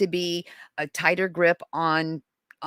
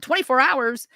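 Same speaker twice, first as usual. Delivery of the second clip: higher-pitched, indignant disbelief; incredulous echo; 6 semitones higher, 3 dB louder than usual. Retold or imitated. Mother balking at the police waiting rule, incredulous. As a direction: throw it back in disbelief.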